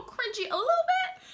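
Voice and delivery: laughing. Real, not acted. Laughs while calling it cringy.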